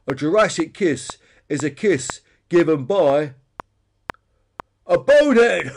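gloating bonehead Peter. A narrator's reading. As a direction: draw out the riddle, then yell the punchline. Peter builds to his punchline.

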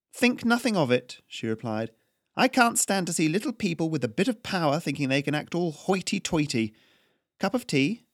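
cool aristocratic challenge; imperious noblewoman Haryana. Acted. Noblewoman Haryana challenges the tax collector, imperious and crisp.

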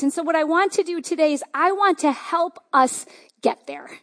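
usual speaking voice

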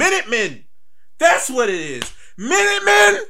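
loud, high burst of sudden recognition; excited shout. Real, not acted. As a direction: shout it out, thrilled to remember. Remembering the show's name.

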